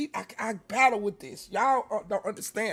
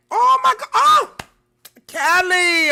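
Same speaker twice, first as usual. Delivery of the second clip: overwhelmed cry; 9 semitones higher, 9 dB louder, slower than usual. Real, not acted. Overwhelmed by another viewer.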